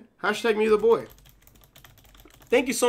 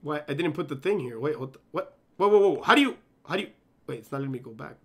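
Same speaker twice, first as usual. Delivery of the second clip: usual speaking voice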